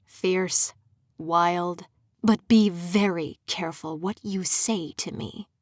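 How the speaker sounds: a hint of a hissing villain; mild menacing hiss; low hiss. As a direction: warn her, low and hissed. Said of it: "he hissed, lowly".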